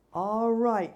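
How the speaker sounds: put-on loud hollering drunk; hollered. A narrator's reading. Drunk laborer squaring up to the narrator, hollering. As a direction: holler it to start a fight. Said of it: "he hollered".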